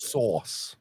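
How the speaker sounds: low, put-on New York vowel; New York accent. Shown as a demonstration. Host proving his New York accent to co-host. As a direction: show off New York with one word. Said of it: "that's a good New York accent".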